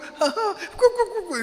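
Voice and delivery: put-on high giggling child's laugh; playful high baby giggle. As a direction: imitate a baby's belly laugh. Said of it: "it starts laughing".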